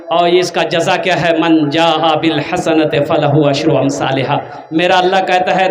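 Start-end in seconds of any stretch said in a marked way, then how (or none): 1.4-4.7 slow, low chanted Arabic verse; Quranic recitation. Real, not acted. Preacher reciting scripture to the crowd, slow chanted Arabic. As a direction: intone the verse slowly.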